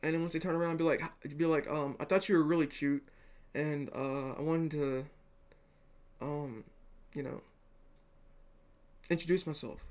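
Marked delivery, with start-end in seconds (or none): 2.0-9.8 hesitant, halting, trailing softer; nervous introduction. Pretend. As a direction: act out a shy, fumbling introduction. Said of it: "You're gonna be nervous".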